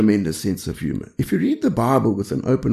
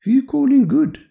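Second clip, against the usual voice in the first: much higher, teasing comeback; wry retort; 12 semitones higher than usual. Retold or imitated. The Lord answering the fawning ruler, high teasing retort. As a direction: tease him with a sharp, amused challenge. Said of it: "then says to him".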